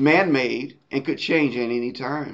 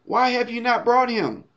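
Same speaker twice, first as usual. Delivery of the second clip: higher-pitched questioning, voicing the Pharisees; 7 semitones higher than usual. Pharisees to officers who showed up without him, questioning. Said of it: "they're questioning".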